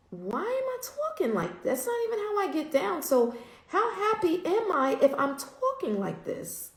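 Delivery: high-pitched retold disbelief; startled self-questioning. Woman retelling her thoughts on her own cursing, startled disbelief.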